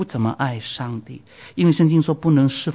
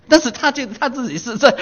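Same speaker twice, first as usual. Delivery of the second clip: high laughing aside at the irony; 10 semitones higher, 3 dB louder than usual. Real, not acted. Preacher laughing at the Mexican student's irony. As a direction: laugh through the words in disbelief.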